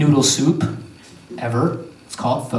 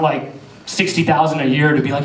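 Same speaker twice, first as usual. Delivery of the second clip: normal talking voice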